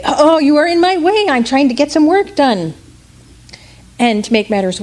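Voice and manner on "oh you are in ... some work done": much higher, exasperated grown-up; annoyed adults. Acted. Adults complaining the boy blocks their work, exasperated. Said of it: "And the adults would say".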